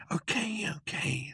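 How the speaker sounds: high, soft, drawn back; placating. A narrator's reading. Ford calms a shouting Zaphod, soft and placating. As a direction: soothe him softly, high and light.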